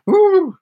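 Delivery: high, loud quivering "ooh"; a playful high whoop of nerves; fluttery "ooh". Acted. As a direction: imitate a queasy flutter in the belly. Imitates the fluttering queasy feeling.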